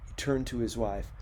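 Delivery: ordinary talk, nothing marked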